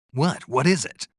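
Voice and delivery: startled. Acted. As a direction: ask it with sudden alarm. Startled reply to radio contact.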